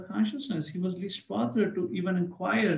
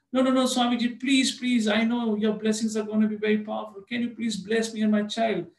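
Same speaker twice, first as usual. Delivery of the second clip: insistent pleading; 5 semitones higher than usual. Retold or imitated. Father keeps begging Prabhupada for blessings, insistent plea. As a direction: refuse the refusal, beg harder.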